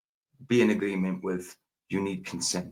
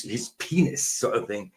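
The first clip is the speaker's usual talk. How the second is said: usual speaking voice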